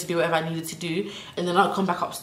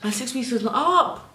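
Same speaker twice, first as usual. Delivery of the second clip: higher panicked voice aloud; 5 semitones higher than usual. Retold or imitated. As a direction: say it in alarm, full voice.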